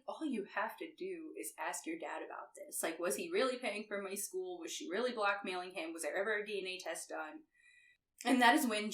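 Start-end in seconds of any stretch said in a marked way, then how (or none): 0.1-7.4 much softer, low, insistent; quiet insistence. Retold or imitated. Mustang urging Jensen to ask his dad, quiet and low. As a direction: press him quietly with questions. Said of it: "Mustang's like".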